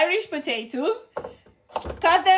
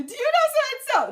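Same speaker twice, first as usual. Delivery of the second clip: high-pitched mock eager question; exaggerated curious asker; imitating nosy askers; 12 semitones higher than usual. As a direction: mimic people asking, pitched way up. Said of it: "They ask you".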